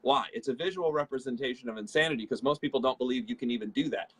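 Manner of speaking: ordinary talk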